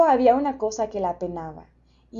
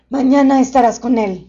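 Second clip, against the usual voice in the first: loud, booming gentle beast; friendly monster voice; 3 semitones higher, 11 dB louder than usual. A narrator's reading.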